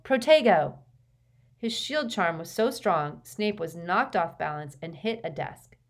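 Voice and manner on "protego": loud, high cry of the spell; sudden high yell. A narrator's reading. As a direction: shout it on instinct. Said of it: "he yelled".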